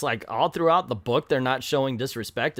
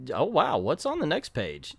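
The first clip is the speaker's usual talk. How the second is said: usual speaking voice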